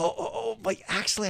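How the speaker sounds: high-pitched, hesitant objection; put-on flustered protest; nervous stammering. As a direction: try to back out politely. Pastor nervously trying to decline.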